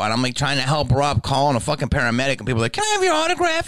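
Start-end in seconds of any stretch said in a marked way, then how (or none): 2.7-3.7 imitating a pushy fan, much higher; clueless autograph seeker, pitched up. Fan asking while his mother is hurt, high voice.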